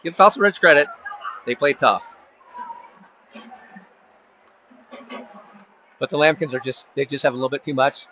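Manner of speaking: live sports call, running commentary pace; play-by-play. Acted. Announcer calling the game for listeners, live play-by-play. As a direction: narrate each play as it unfolds.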